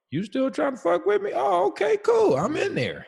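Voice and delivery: very high, loud, squeaky mock astonishment; hammed-up high-pitched disbelief; pleasantly surprised. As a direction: leap into a falsetto of delighted surprise. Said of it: "there's times I'm surprised".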